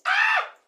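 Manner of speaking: very high, loud cry of realization; excited shriek. Real, not acted. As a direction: let out a startled yelp. Shocked realization.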